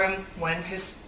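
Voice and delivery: holding back tears mid-sentence; teary-eyed. Real, not acted. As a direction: voice catching with tears. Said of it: "Sorry, I'm getting teary-eyed".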